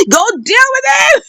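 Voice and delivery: high-pitched gleeful cheer; delighted and happy. Acted. Mother egging on her son in the fight, delighted and happy. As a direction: egg him on, voice leaping high. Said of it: "mother was delighted and happy".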